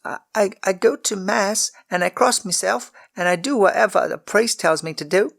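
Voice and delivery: high, stammering, defensive; a child insisting she is good; defensive girl. A narrator's reading. Servant girl defends herself to the lord, high and defensive. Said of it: "she persisted defensively".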